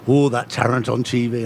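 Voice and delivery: imitated Carlisle interviewer, low-pitched; Carlisle accent. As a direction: chat in a put-on Carlisle accent. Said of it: "slipped into my Carlisle accent".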